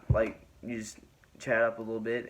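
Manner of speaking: ordinary talk, nothing marked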